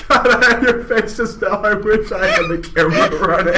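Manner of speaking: can't stop laughing while speaking. Real, not acted. Host cracking up at guest.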